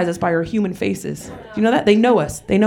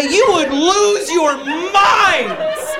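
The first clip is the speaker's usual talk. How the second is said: slow emphatic; 9 semitones higher, much slower than usual. Acted. Building to punchline, stretched.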